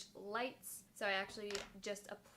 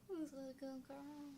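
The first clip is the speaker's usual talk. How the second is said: talking to a dog; 6 semitones higher, 6 dB quieter, slower than usual. Real, not acted. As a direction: coo at the puppy. Speaker coos at the dog.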